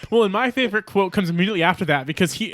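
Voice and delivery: ordinary delivery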